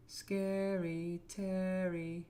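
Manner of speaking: slow, high, drawn-out spooky name; mock-spooky, savouring the name at a crawl. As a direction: stretch it out like a playful ghost story. Repeats name in a spooky drawl.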